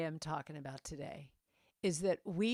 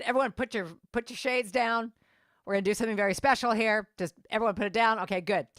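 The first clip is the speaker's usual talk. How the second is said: louder, higher flight attendant orders; commanding; 3 semitones higher, 6 dB louder, faster than usual. Retold or imitated. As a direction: imitate a take-charge attendant addressing passengers. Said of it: "the way she said it was so commanding".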